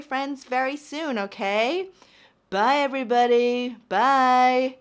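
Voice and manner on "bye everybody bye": louder and louder, calling bye; loud goodbye. Real, not acted. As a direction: wave the viewers off loudly.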